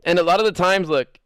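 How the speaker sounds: ordinary talk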